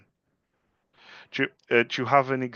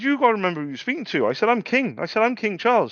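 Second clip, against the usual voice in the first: higher, fast, lordly boast; hammed-up royal swagger; mock king; 10 semitones higher, 3 dB louder than usual. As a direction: play the self-appointed king.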